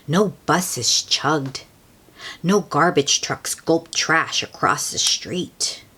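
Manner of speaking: plain reading voice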